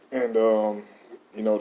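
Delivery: ordinary talk, nothing marked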